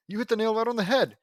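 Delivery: high, loud, eager agreement; enthusiastic agreement. Acted. Agreeing to the plan with Lincoln, enthusiastic. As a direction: agree with gusto.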